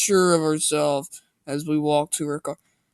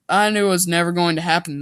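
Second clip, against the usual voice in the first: plain reading voice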